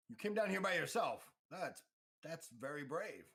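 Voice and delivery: host NPC complimenting the lone scout. Acted. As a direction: praise the bravery with a knowing edge. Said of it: "and goes".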